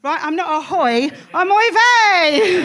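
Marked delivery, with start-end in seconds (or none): 1.3-2.6 much higher, slow, loud oy vey; drawn-out punchline. Acted. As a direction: stretch it out high and loud.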